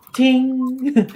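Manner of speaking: high bright ting, like a chime; ting sound effect. Pretend. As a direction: chime the spell on. Sound of spell lighting up.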